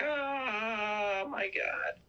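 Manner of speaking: high, drawn-out "oh" of horror; mock horrified cry. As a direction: imitate a horror victim crying out. Retells Sienna's terror at crown of thorns.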